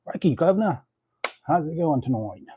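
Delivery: mock Australian twang; jokey fake Australian greeting. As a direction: put on a hammy Aussie voice. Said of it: "That's Australian".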